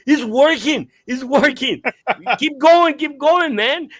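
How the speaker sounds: loud, high retold cheering on; excited friend calling out encouragement; excited urging. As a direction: urge them on, laughing.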